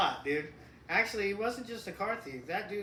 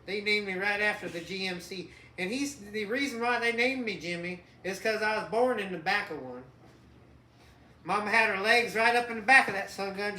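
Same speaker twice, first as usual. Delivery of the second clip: put-on light Southern country accent, a little louder; 3 semitones higher, 9 dB louder than usual. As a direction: play a good-ol'-boy Southern mechanic named Jimmy. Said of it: "started talking with a southern accent".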